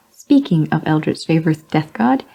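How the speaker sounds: usual speaking voice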